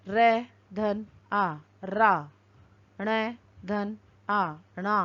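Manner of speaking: slow letter-by-letter building of पर्णम; spelling out letters. Shown as a demonstration. Teacher spelling a word for the class, slowly. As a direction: drag out each sound as you join them. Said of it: "वर्णों का संयोजन करके".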